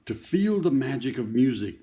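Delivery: lively picture-book read-aloud; reading to children. Acted. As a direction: tell the story to a circle of kids.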